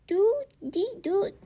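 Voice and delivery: high squeaky doot dee doot; happy chuckle. A narrator's reading. Guinea pig having his chin scratched, happy high chirps. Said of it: "he chuckled happily".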